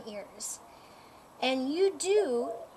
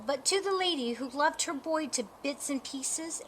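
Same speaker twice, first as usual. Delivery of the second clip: ordinary delivery, nothing marked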